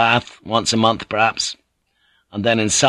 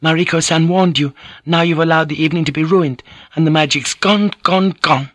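higher, self-berating voice; a geisha angry at herself, voiced higher; self-reproach; 7 semitones higher than usual. A narrator's reading. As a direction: scold yourself for the ruined evening. Woman berating herself inwardly.

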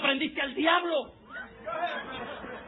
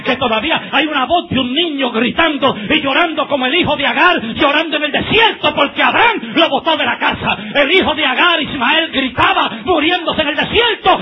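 louder and louder fiery sermon; preaching at full force; 4 semitones higher, 14 dB louder than usual. Acted. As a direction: shout it out to the whole church.

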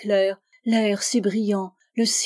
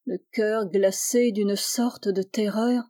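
plain reading voice

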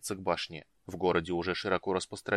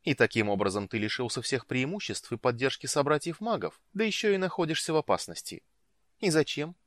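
higher-pitched animated reproach; lively, scolding woman; 6 semitones higher than usual. A narrator's reading. Woman scolds the mage over the conclave, animated reproach. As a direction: chide him for a needless mistake. Narrator voices Iolanta reproaching.

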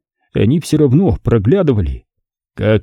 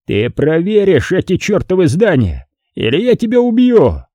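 angry threat; 8 semitones higher than usual. Acted. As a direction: pitch climbing into a menacing threat. Boss threatens Carter with revolver. (угрожающе, голос поднимается к концу)

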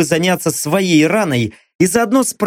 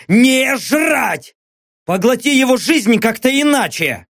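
high shouted order; barked command; 7 semitones higher than usual. Acted. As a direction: yell the order at the beast. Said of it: "снова рявкнул Лёха". (громкий приказ криком)

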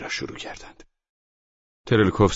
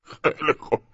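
high, wheezy voice choking on laughter; slow breathless wheeze, higher than usual; wheezing laughter; 9 semitones higher, 4 dB louder than usual. Acted. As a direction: gasp the words out through laughing. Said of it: "خسخس کنان گفت".

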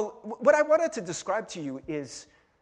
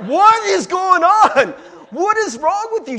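much higher and louder, incredulous; exasperated outburst; 12 semitones higher, 14 dB louder than usual. Acted. Preacher mocking a lovestruck friend, exasperated outburst. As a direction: throw up your hands at him.

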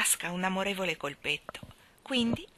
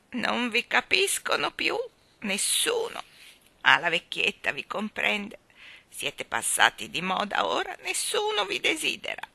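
put-on loving old woman; 3 semitones higher than usual. Old woman speaks lovingly to her balloons, old quavering voice.

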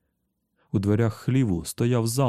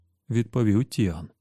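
ordinary delivery, nothing marked

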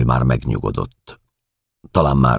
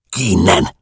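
sharp hiss, higher than the narration; hissed order; 7 semitones higher, 4 dB louder than usual. A narrator's reading. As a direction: hiss it to drive someone out. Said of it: "sziszegte felém az egyik nő". (sziszegve elzavarva)

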